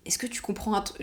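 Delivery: breathy hushed whisper, no voice; whispered question. Retold or imitated. Classmate secretly asking the new girl in class, whispering. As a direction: lean over and whisper it so the teacher won't hear.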